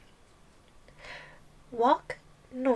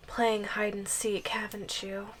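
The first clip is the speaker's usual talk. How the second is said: soft unvoiced taunt; teasing whisper; 2 semitones lower, 5 dB quieter than usual. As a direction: whisper it like mocking a caught pet.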